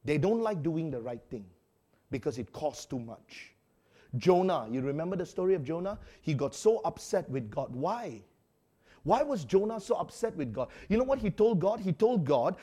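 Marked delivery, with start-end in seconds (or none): none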